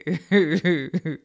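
short, loud run of chuckles; gloating chuckle. Acted. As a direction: chuckle with relish at their reaction. Mistress enjoys the reactions.

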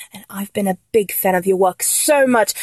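normal talking voice

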